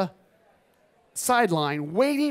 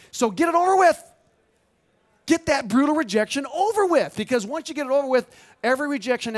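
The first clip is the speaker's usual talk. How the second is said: loud, high, emphatic urging; forceful exhortation; 8 semitones higher, 5 dB louder than usual. Real, not acted. Speaker urges photographers to face rejection, forcefully. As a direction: push the audience hard, pitch way up.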